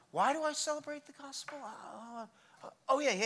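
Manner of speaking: put-on puzzled voice trailing into soft ums; forgetful musing. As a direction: play someone searching their memory, then remembering. Plays someone forgetting, then remembering.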